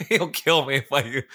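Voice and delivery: real laughter through the words; laughing. Real, not acted. Laughs while confessing friend's secret.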